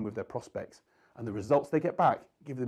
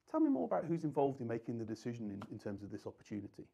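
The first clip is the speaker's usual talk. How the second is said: softer, caring enquiry; sincere and interested; 5 semitones lower, 8 dB quieter, faster than usual. Shown as a demonstration. Salesman gently asking the prospect who decides, softly sincere. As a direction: ask like you truly want to know. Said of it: "shows empathy and shows sincerity".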